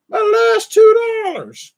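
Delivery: very high, drawn-out mock lament; hammed-up high-pitched plea; mock wail. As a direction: wail it slowly in a silly high voice. Mock lament over two dollars.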